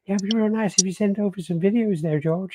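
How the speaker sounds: high, wheedling put-on voice; impression of a caller. Caller asks George to send videos, high put-on voice. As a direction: pitch it up and ask sweetly for a favour. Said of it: "he's literally like".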